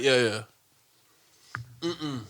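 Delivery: words squeezed through gritted teeth. Retold or imitated. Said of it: "Start talking through my teeth to her".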